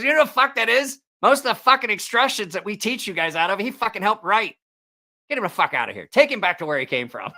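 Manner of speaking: high, forceful scolding, re-enacted; retold chewing-out. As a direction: act out a boss dressing down a rookie. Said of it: "this guy got chewed the fuck out".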